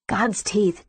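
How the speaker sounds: usual speaking voice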